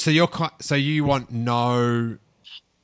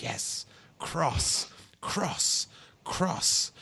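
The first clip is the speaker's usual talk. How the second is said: hushed, voiceless repeats with pauses between; hushed savouring; 4 semitones higher, 8 dB quieter, much slower than usual. Acted. Fan relishing her line "until our paths cross", hushed savouring. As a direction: softly echo the word, almost whispered.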